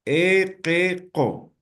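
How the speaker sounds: "E, que, co" in separate syllables with E and O; syllable-by-syllable allophone vowels. Shown as a demonstration. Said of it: "voy a pronunciar".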